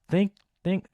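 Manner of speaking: high-pitched imitated blows; two sharp high hits; mock impact sounds. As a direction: make high punching sound effects. Imitating headrest punches.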